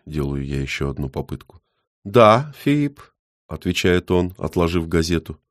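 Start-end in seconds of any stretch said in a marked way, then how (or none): 2.0-3.0 put-on old man voice.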